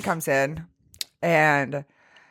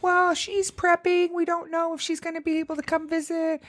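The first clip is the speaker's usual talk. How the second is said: a breezy high quote of the doctor; doctor's words; 10 semitones higher than usual. Retold or imitated. Retelling what the doctors said, higher mock voice.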